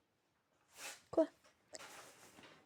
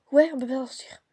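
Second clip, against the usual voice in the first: louder agreement; 2 semitones higher, 8 dB louder than usual. Real, not acted. Agreeing aloud.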